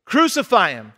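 loud, emphatic mob demand; imitating a crowd's shout; retold crowd yell. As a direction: yell it like an angry crowd, in short bursts. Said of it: "And the crowd yelled".

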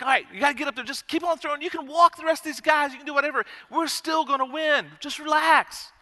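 high-pitched, hurried coaching; nervous dad trying to sound calm; frantic pep talk. Retold or imitated. Dad urging his son on the mound, high and keyed up.

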